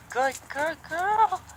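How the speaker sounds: high, slow, sing-song praise for the horse; drawn-out praise. Real, not acted.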